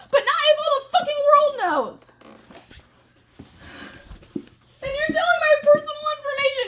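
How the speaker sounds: high-pitched tearful yelling; angry crying. Real, not acted. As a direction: shout through tears, voice high and cracking. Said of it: "You really mad about working at Jer".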